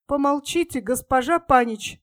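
plain reading voice

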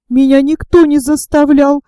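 ordinary delivery

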